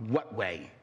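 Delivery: low, curt, challenging comeback; defensive retort. Retold or imitated. Speaker answers the bold attendee, curt and defensive. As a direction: throw the question back at him, daring him. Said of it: "I said back in this tone".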